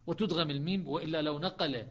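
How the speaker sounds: normal talking voice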